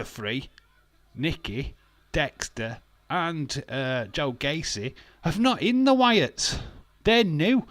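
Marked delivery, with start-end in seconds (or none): 5.2-7.7 loud, high, slow ranting shout; fed-up streamer yelling at chat; exasperated shout. Real, not acted. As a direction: blow up at the fans' complaint. Ranting about the new Wyatts.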